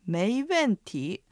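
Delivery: slow メイウェンティー, drawn out with rise, fall, rise; slow tone demonstration. Shown as a demonstration. Co-host repeats the phrase for learners, slow tone demonstration. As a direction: sound out each tone of メイウェンティー at length. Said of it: "アクセントと合わせて発音してみましょう".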